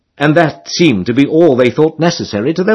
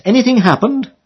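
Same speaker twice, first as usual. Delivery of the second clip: put-on much higher girlish call; Emily calling; 10 semitones higher than usual. A narrator's reading. Emily asking the rower what he wants, high girlish call. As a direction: ask brightly like a young girl.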